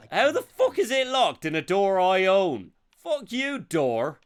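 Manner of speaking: loud high swearing at a door; frustrated yell. Real, not acted. Streamer to a locked door in the game: angry swearing.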